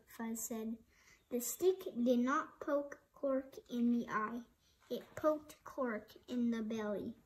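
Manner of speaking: plain reading voice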